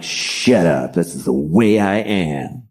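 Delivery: low, slow mock brooding; hammed-up macho brush-off; tough-guy Matt Damon. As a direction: play a moody brawler, dragged out. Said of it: "And I'm like".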